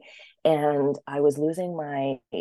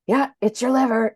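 doctor quote; 8 semitones higher, 6 dB louder than usual. Retold or imitated. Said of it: "he's like".

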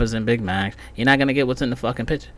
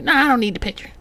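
higher, dismissive clerk voice; voicing the Publix worker in a higher pitch; clerk's reply; 12 semitones higher, faster than usual. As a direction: brush it off like the lazy clerk.

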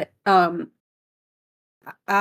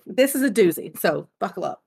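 usual speaking voice

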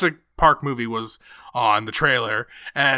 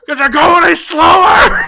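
high, loud mock driver's rant; imitated traffic yelling; 13 semitones higher, 9 dB louder than usual. Partner imitating driver coming home in traffic, loud mock yell. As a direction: shout it like an exaggerated impatient commuter. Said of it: "coming home in traffic when he's honking".

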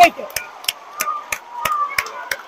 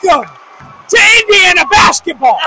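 loud, thrilled announcement; excited shout; 6 semitones higher, 5 dB louder than usual. Real, not acted. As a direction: yell it out in celebration. Celebrating overtime with a friend.

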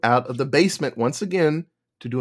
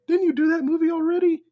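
much higher, fast voice of a listener; high questioning voice; faster than usual. Pretend. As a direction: ask it as a nitpicking fan.

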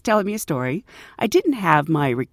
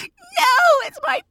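put-on much higher, sobbing child's wail; crying kid. Boy begs the flight attendant to keep his snake, high crying wail. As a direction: whimper and wail like a boy losing his pet. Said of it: "The kid's like".

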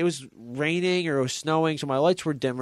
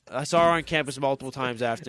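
ordinary delivery

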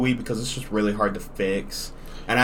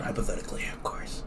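sly whispered aside; 2 semitones lower, 13 dB quieter, faster than usual. Real, not acted. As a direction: whisper it like a secret. Joking aside about carts.

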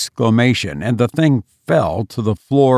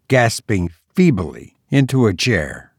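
ordinary delivery, nothing marked

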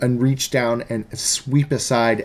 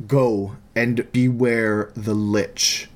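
ominous devil warning; 2 semitones lower than usual. Acted.

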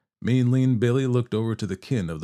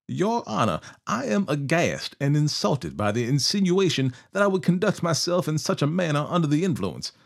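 higher, hammed-up aghast protest; mock offended outlaw; 7 semitones higher than usual. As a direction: put on wounded dignity for the judge. Billy feigns shock and insult.

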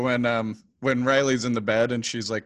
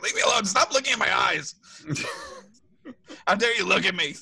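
pitched-up, comically touchy protest; mock defensive; 5 semitones higher than usual. Podcaster acts his reply to comments on puffy eyes, mock defensive. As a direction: overreact as if affronted, half laughing. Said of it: "So defensive".

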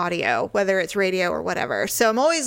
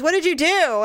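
higher-pitched mock irritation; irritated husband; 6 semitones higher than usual. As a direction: imitate an annoyed husband demanding answers. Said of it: "Now he's irritated".

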